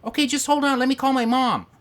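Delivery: high, loud mock-wife voice; imitated wife. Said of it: "And she's like".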